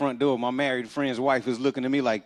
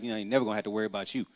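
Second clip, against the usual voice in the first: very fast, lower muttered aside; rushed aside; 6 semitones lower, 8 dB quieter, much faster than usual. Retold or imitated. Mother muttering to her son, quickly and low. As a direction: rattle it off quickly under the breath. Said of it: "She was like".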